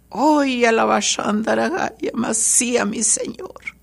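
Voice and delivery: ecstatic prayer in tongues, breathy and rising; murmured glossolalia to the Lord; speaking in tongues. Real, not acted. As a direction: pour out the tongues in fervent prayer. Praying in tongues in a testimony.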